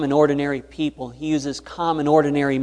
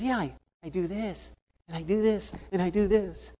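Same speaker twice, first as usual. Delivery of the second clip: higher, softer small man's voice; hushed and higher, patiently showing; Ramon's voice; 5 semitones higher, 7 dB quieter than usual. Retold or imitated. Ramon calmly repeating his method to Finley, higher and softer.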